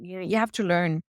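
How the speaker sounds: usual speaking voice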